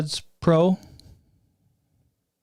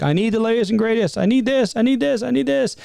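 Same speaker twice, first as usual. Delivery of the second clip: put-on higher, whiny kid pleading for gadgets; mock demanding kids; 6 semitones higher than usual. Kids begging for the latest phone, higher and insistent. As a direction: play a kid who must have the newest phone. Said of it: "the kids are like".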